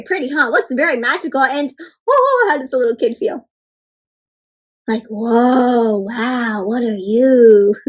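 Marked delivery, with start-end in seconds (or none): 4.9-7.7 put-on wide-eyed child voice, louder; a kid meeting magic; awed kid. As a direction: gasp in wonder at the creature. Voicing the kid in picture.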